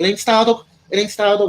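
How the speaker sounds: high, insistent Manchester dealer; mock Manchester accent. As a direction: correct them as a caricature Mancunian. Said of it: "And his Manchester accent".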